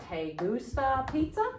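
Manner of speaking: "pizza" rising into a question; sounds like asking. Shown as a demonstration. Teacher modelling a question for class, rising on "pizza". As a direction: lift the pitch on "pizza". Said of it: "make sure it sounds like you're asking".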